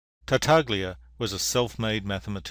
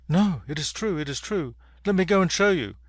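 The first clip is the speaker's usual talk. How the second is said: lightly voiced rabbit, pitched up and insistent; mildly acted higher-pitched protest; 7 semitones higher than usual. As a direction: plead your case as a small rabbit, a touch higher.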